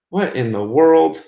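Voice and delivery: slow, drawn-out disbelief; baffled. Real, not acted. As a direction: stretch it out, baffled by the glitch. Skype charging again, host baffled.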